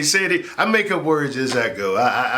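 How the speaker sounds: normal talking voice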